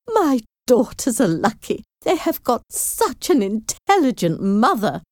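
high, boastful fussy mother; self-satisfied mother. Acted. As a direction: crow over your own cleverness. Mrs Bennet boasting.

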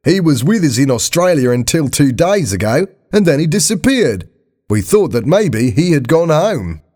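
high, lively Australian caller; chatty Australian, pitched high; Australian accent. A narrator's reading. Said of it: "a voice with an Australian accent".

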